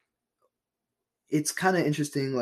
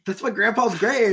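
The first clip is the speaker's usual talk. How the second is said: mock indignant; 7 semitones higher, 3 dB louder than usual. Acted native complaint.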